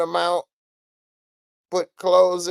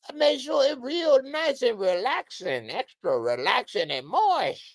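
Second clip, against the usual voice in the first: [name] impression; 12 semitones higher, 4 dB louder than usual. Host mimicking [name] bragging about baby oil, high and smooth. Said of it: "That's my [name] impression".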